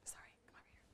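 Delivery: a soft unvoiced aside; whisper. Real, not acted. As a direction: whisper it so the class doesn't hear. Aside to child helper.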